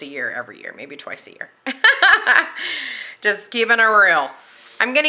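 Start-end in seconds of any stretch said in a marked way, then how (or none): 1.7-3.2 high giggling laugh. Real, not acted. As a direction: laugh at yourself. Laughs at own extravagance.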